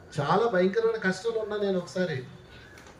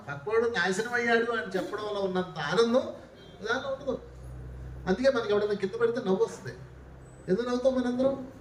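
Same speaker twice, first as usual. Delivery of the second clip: normal talking voice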